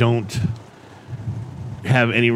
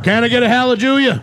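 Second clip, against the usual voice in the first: high preacher call; 6 semitones higher, faster than usual. Acted. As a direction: rouse the congregation. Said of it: "this new character called Pastor Pat".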